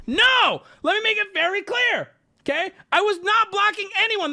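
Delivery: high, loud, insisting he wasn't blocking; indignant shout. Real, not acted. Streamer to chat, shouting his innocence. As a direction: yell the denial up high.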